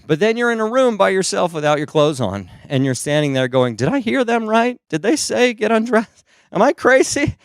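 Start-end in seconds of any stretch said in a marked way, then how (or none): 3.8-7.4 high-pitched comic self-questioning; mock anxious doubt. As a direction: fret aloud, voice pitched way up. Acts second-guessing, alone and undressed.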